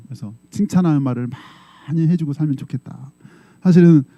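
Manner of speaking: ordinary talk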